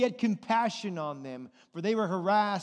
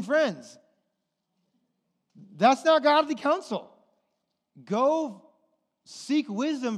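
raised, louder warning voice; forceful preaching; 5 semitones higher, 5 dB louder than usual. Real, not acted. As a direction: preach it hard to the congregation.

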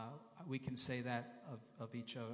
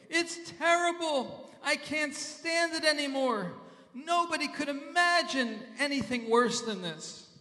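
high, hammed-up wail of complaint; loud complaining; 13 semitones higher, 11 dB louder than usual. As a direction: act out a miserable man griping at full volume. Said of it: "someone who's complaining the entire time".

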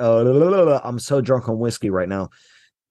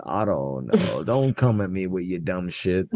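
low, dismissive mock rant; 7 semitones lower than usual. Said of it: "mock rant at the manager".

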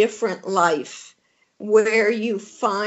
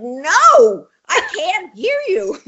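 loud, high comic refusal; exasperated retold outburst; 9 semitones higher, 7 dB louder, slower than usual. Retold refusal to whispering therapist.

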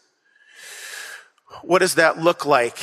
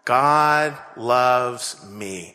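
congregation saying it together; 4 semitones lower, much slower than usual. Real, not acted. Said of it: "say that really loud together".

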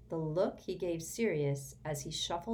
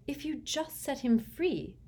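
higher, hopeful suggestion; 10 semitones higher than usual. A narrator's reading. Hermione hopefully suggests freeing the elf. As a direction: offer it with hope. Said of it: "said Hermione hopefully".

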